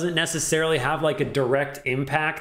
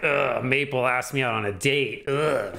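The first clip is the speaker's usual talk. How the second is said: dismayed groan of complaint; negative inflection; 2 semitones lower than usual. Shown as a demonstration. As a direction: groan it as if it's bad news. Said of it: "it might be automatically assumed as negative".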